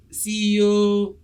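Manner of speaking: slow, drawn-out hype; hype man intro. Acted. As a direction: hype her up, slowly. Hyping up the guest.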